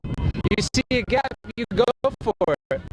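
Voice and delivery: excited. Real, not acted. Excited call on a try break.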